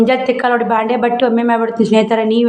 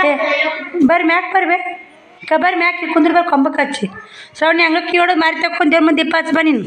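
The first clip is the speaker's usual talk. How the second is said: much higher-pitched household call; 6 semitones higher, faster than usual. Real, not acted. Calling visitors up and sending a girl to light the lamp, calling out. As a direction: pitch it up to summon and instruct. Calling people over, directing Shravani.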